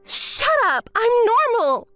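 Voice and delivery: high-pitched angry denial; heated denial, pitch jumping up; defensive outburst. Acted. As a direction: insist indignantly, voice high. Insists after being called unstable.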